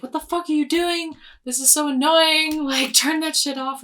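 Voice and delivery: loud, high angry complaint; annoyed passerby yelling at the noise; annoyed stranger. Retold or imitated. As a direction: tell them off, fed up. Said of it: "it totally pissed someone off".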